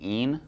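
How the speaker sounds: 'ene' pronounced to rhyme with 'seen'; 'een' ending. Shown as a demonstration. Teacher showing students the word ending, slow 'een'. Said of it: "The end, we're saying".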